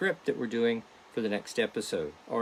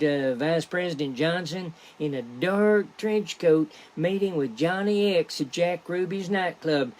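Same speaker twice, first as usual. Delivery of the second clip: higher, louder eager Bobby Ray; Bobby Ray insisting; 5 semitones higher, 3 dB louder than usual. Acted. Bobby Ray insists to Big D about the hit, higher and excited.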